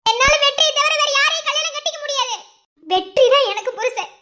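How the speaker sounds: high, loud crying defiance; tearful shout. Acted. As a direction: shout it through tears. Daughter refuses arranged marriage.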